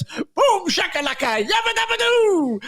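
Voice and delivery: high, slow, gleeful chant; gleeful radio quote. Retold or imitated. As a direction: sing it out like a giddy winner. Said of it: "That is the moment of the race".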